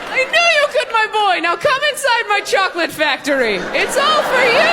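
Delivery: very high, gushing Willy Wonka voice, stretching the praise out; a proud, delighted eccentric candy-maker crowning the winner; Willy Wonka style. Retold or imitated. To the date who passed the test, a high gushing proud voice. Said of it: "And I'm like".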